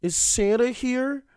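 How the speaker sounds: high, loud put-on excitement; imitating an eager believer in Santa; excited mock question. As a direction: pitch it way up in hopeful surprise. Retold excited character spotting Santa.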